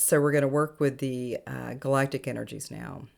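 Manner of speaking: usual speaking voice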